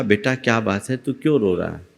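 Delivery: soft, low coaxing question; coaxing softly. A narrator's reading. Old woman coaxing the weeping youth, softly and tenderly. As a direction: gently soothe a crying boy. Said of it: "उसे पुचकार करके पूछा".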